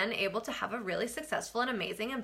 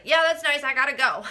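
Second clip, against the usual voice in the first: loud, higher mock brush-off; dismissive brush-off; 4 semitones higher, 7 dB louder than usual. Wife not wanting to hear husband's feedback, loudly dismissive. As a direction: cut him off loudly, imitating your annoyed self.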